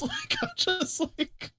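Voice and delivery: breaking into slow laughter mid-sentence; laughing. Real, not acted. Streamer laughing at her own story, slow and high. Laughing while retelling friends' reaction.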